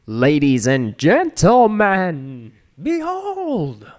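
grand, slow showman announcement; showing off. Retold or imitated. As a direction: proclaim it like a cartoon villain. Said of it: "That was from Aqua Teen Hunger Force".

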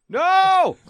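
high, loud mock Dracula cry; Dracula yelling no. Joking about a Dracula story ending, loud mock no. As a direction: cry out no like a beaten vampire. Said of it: "ends with him just going".

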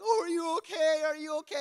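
put-on high, anxious fussing over a hurt child; worried adults. Adults rushing to the hurt kid, high worried fussing. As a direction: coo it high like panicked grown-ups. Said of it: "They're all like".

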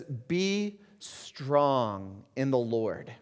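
each word drawn out, slow and weighty; solemn slow scripture quote. Acted. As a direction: let "Be strong in the Lord" hang, very slowly. Quoting Paul slowly.

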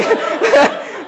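squeaky laughing burst; high giggle. Real, not acted. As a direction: giggle at the absurd idea. Laugh at tackling thought.

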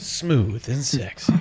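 slow smooth seductive read; smooth sexy voice. Pretend. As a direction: purr it out slowly like a seduction. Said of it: "you get a gist of my voice".

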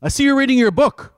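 high, loud, severe call into the cell; stern warden. Retold or imitated. Warden spotting the prisoner reading his Bible, loud and stern. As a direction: make it sound like a warning from a strict warden. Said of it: "She says".

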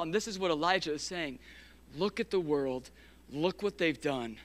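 ordinary talk, nothing marked

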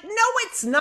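high-pitched indignant contradiction; exasperated retort. Retold or imitated. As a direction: argue back at mother in retold exasperation. Retold argument with her mother.

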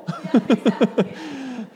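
long, high-pitched burst of laughter; real laughter. Real, not acted. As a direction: laugh out loud at a joke. Laughing at the tetherball joke.

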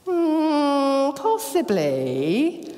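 a slow, grudging maybe; half-convinced, drawn-out hesitation; lightly voiced unsure disciple; doubtful disciples. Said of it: "So Jesus says".

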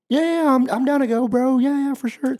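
high-pitched mock hype; eager friend. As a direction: ham up a hyped friend agreeing fast. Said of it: "And you're like".

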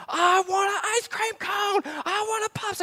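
mock toddler wail for ice cream; toddler tantrum whine. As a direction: whine it like a two-year-old throwing a fit. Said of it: "she is just like".

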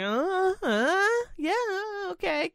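nodding along without understanding a word; fake agreement. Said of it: "I'm just kinda like".

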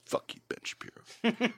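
breathy whispered fuck-you under the breath; whispered curse. Retold or imitated. Quietly cursing out Ben Shapiro in his head, whispered. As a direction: hiss it like a private grudge.

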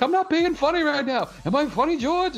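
high-pitched, indignant Seinfeld impression; mock outrage, voice shooting way up; pitchy angry Jerry. Jerry insisting to George he is serious, pitchy mock anger. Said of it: "his voice gets real pitchy".

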